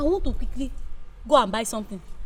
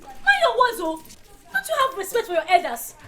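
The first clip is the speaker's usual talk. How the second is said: sharp high rebuke to a rude youngster; indignant elder snapping a warning; angry scolding; 6 semitones higher, 3 dB louder than usual. Acted. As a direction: scold him hard for his cheek.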